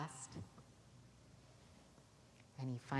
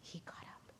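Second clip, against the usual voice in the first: whisper; 14 dB quieter than usual. Acted. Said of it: "echo whisper".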